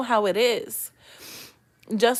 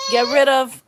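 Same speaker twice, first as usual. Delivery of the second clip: crying; 2 semitones higher, 8 dB louder than usual. Real, not acted. Breaks down while advising viewers.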